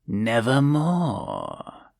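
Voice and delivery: slow, drawn-out croaking Nevermore; raven's croak. A narrator's reading. The raven answering the narrator, slow croak. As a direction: let the bird intone it slowly. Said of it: "Quoth the raven".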